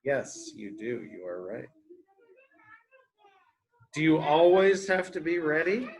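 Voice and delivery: normal talking voice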